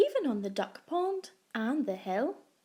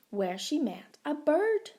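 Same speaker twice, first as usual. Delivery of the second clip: plain reading voice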